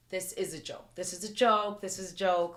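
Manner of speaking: insistent denial. Retold or imitated. Disbelief under gunfire, repeating.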